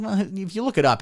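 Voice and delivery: mock retort. Own retort in acted argument.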